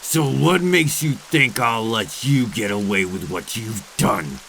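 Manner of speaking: ordinary talk, nothing marked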